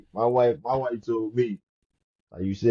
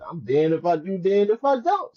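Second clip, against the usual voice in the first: exasperated; 7 semitones higher, 3 dB louder than usual. Retold or imitated. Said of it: "I'm like".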